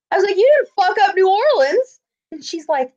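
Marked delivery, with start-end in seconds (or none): 0.4-2.0 high-pitched retold protest; indignant comeback. Fan arguing with guest relations, indignant. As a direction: throw it back, indignantly high. Teller confronting staff.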